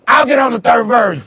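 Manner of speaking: high, loud, eager yell; drunk shout. Retold or imitated. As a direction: jump in shouting. Drunk him volunteering, shouting.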